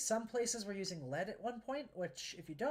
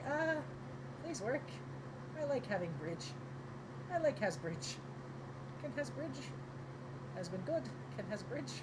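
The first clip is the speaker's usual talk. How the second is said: caveman voice; 6 semitones higher than usual. Pretend. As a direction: plead like a simple caveman who wants his bridge. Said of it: "It's like".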